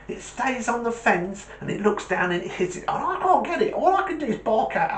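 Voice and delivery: high-pitched, indignant small-dog voice; high, worked-up terrier complaining; aggrieved little terrier. Acted. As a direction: whine about the cat in a high voice.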